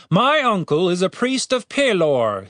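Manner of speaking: high-pitched character voice; guard captain, challenging. A narrator's reading. Captain to the cleric, challenging. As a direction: pitch it up, pressing the point.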